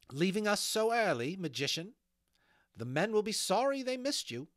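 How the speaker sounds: high, loud mocking call to the magician; taunting Molly. Molly catches the magician leaving early, taunting. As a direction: sing out a sardonic jibe, pitched well up.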